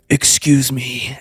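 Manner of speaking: slow, loud, drawn-out excuse me; mock indignant interruption, stretched out; ogre-like scolding. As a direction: imitate an outraged woman confronting an intruder. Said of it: "this ogre of an energy came behind me".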